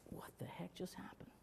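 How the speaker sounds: breathless whisper of disbelief; stunned whisper. Real, not acted. Father dazed after losing-the-baby news, whispering.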